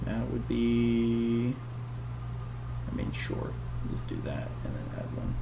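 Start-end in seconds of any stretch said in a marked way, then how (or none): 2.9-5.4 whispering. Real, not acted. Whispered aside during rules.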